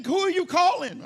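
ordinary talk, nothing marked

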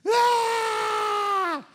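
high, long mock crying scream; imitated child's wail. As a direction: wail like a hurt little kid. Imitating child who hit head.

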